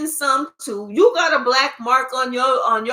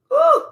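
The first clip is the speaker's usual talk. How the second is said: high, loud sudden whoop of joy; real excited woo; 7 dB louder than usual. Real, not acted. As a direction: let out a celebratory woo. Sudden cheer mid reading.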